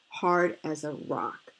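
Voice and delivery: ordinary delivery, nothing marked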